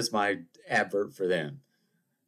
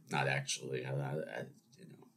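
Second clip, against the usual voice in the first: quiet aside; 7 semitones lower, 10 dB quieter than usual. Real, not acted. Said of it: "we like hyperbole on this show".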